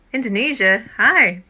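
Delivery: loud, delighted hello; excited greeting. Real, not acted. A streamer greeting Indonesian viewers, calling out excited.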